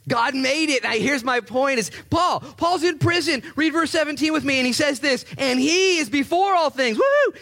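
preaching at full force. Acted. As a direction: lift the room with preaching fire.

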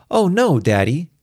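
put-on shrill, gleeful child voice; high and loud with childish glee; Star. A narrator's reading. Daughter brushing off her daddy's worry, shrilled in glee. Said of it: "Star shrilled in glee".

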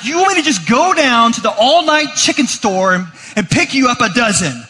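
high, loud exasperated yell; mock flipping out. Dad to son about the baby chickens, flipping out loud and high. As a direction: blow up in disbelief. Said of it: "flipping out".

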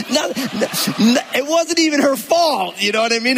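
high, laughing backpedal. Real, not acted. Pastor laughing off his own joke to the congregation.

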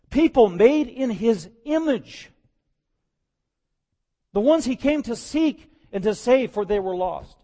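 loud, high, slowed preaching climax; impassioned preaching. Acted. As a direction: swell into a fervent cry over the lost. Preacher building to full force.

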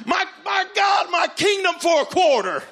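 high, loud, hammed-up desperation; frantic cry. Searching desperately for a quarter, frantic shout.

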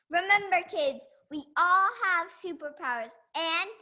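put-on loud, slow, rousing sign-off to kids; inspiring closing line; sign-off announcement. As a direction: proclaim it slowly to the kids. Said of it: "join me in the sign off".

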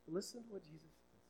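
ordinary talk, nothing marked

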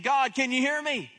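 loud, high, urgent call; David straining to get through to God, loud and high. Acted. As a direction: shout to be heard across a distance. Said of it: "This is David's way of saying".

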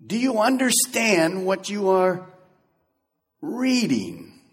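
slow, careful, each word laid out; slow gentle question. Acted. Philip asking the reader gently and slowly. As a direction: ask it patiently, slowly. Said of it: "Notice what Philip does".

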